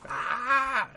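demon shriek. Retold or imitated. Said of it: "you know".

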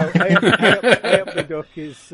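laughing Stoke greeting, loud; a loud Potteries hello through laughter; Stoke accent. Acted. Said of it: "is Stoke".

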